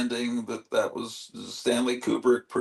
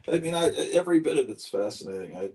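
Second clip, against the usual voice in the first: usual speaking voice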